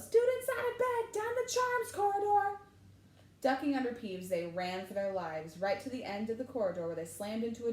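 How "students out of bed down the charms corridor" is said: high, loud sing-song bellow. A narrator's reading. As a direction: announce them gleefully to the castle. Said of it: "Peeves bellowed".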